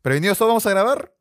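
quick, high call to the set; rally the crew before a take; director's call before rolling. Retold or imitated. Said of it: "antes de empezar a grabar esa toma".

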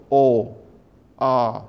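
"o" and "a" each held long; long vowels. Shown as a demonstration. Lecturer demonstrates the long variant of Japanese vowels, vowels held longer. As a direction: draw out "o", then "a", as separate lengthened vowels. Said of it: "Wymawiane są dłużej".

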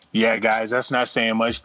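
ordinary talk, nothing marked

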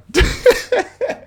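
high-pitched laugh; real high giggle. Real, not acted. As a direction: laugh at your own rant. Laughs at his own warning.

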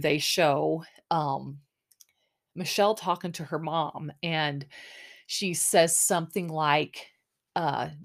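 ordinary talk

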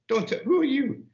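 high-pitched mock squeal of protest; hammed-up offended woman pushing him away. Retelling a TV scene, offended woman fends him off in high mock protest. Acted TV character fending off man.

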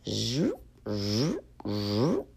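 soft rising zoops like a zipper; zipper sound. Acted. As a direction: imitate a zipper opening. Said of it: "the sound of a zipper".

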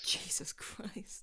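shocked whisper. Real, not acted. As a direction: breathe it out in disbelief. Shocked reaction to dark joke.

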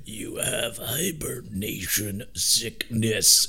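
slow breathy helmet-modulator whisper; whispered modulated voice. Retold or imitated. Leia in disguise whispers to blind Han, slow whisper. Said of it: "And she's like".

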